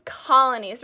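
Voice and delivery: loud, clear classroom model word; clear teacher model. Shown as a demonstration. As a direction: say it out for the class to copy. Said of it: "Repeat that".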